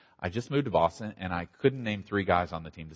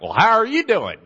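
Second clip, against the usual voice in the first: higher, louder Southern man's greeting; Southern accent; 12 semitones higher, 10 dB louder than usual. Retold or imitated. Southern man greets Bostonians in a loud Southern accent. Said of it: "nice Southern accent".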